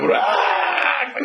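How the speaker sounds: high mock shriek of a coaster drop; mock scream. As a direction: squeal like you're falling.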